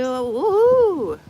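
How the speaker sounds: big high excited whoop; cheer. Real, not acted. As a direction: celebrate loudly, top of the voice. Cheering a fellow Aquarius.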